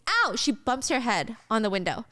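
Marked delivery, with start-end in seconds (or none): 0.1-0.3 high, brief pained yelp; a retold ouch, played up; mock scream of pain. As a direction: yelp as if bumping your head. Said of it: "And Rose screams".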